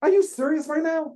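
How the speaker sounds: high, loud, incredulous cry; stunned disbelief. Retold or imitated. Founder hearing [name] offer to invest, crying out high and loud in disbelief. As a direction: shriek it like you can't believe your luck. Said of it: "I'm like".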